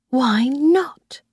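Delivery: a short whispered question, rising at the end. A narrator's reading. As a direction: breathe it as a private doubt. Narrator's inner puzzled question.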